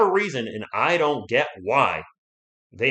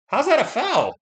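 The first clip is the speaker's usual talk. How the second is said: incredulous; 12 semitones higher, 3 dB louder than usual. Real, not acted. Disputing a foul call.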